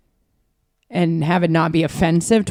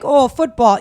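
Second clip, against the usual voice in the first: "football" said loud and higher in a mock British accent; 5 semitones higher, 5 dB louder, slower than usual. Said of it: "if I'm like".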